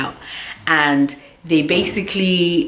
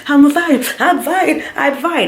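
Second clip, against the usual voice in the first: insisting; 9 semitones higher, slower than usual. Retold or imitated. Insisting to calm mom.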